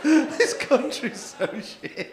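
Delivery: slow, high, loud rant broken by laughter; laughing rant. Real, not acted. Comic ranting about England to the French couple, laughing loudly. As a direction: rant while cracking up.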